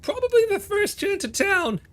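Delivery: put-on high, nervous young voice; a scrawny kid trying to sound brave; pitched far up, timid but eager; Willy's voice. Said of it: "Wilson will just go".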